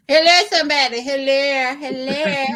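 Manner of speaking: excited hollering. Real, not acted. Cheering a friend's story.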